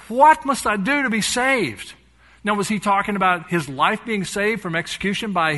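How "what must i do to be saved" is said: high, loud, slow cry for rescue; desperate plea. Retold or imitated. The jailer begging Paul and Silas, loud desperate plea. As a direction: beg it like a terrified jailer.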